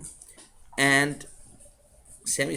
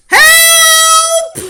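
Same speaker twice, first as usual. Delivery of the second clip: put-on high, loud childish shout; cry for help; 17 dB louder than usual. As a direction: scream for help from the ladder. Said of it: "who was saying".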